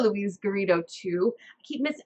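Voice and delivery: ordinary talk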